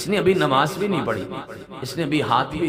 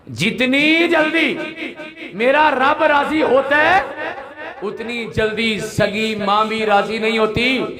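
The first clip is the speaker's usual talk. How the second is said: slow, high, loud cry; impassioned preaching; 9 semitones higher, 4 dB louder than usual. Real, not acted. Preacher proclaiming God's quick pleasure, slow high shout. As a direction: drag each phrase out in a high shout.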